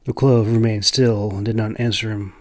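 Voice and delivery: usual speaking voice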